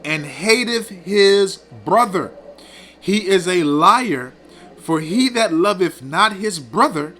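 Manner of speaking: slow, emphatic verse reading; scripture reading. Acted. Preacher proving his point from First John, slow scripture reading. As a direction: read out each phrase deliberately, stretching the key words.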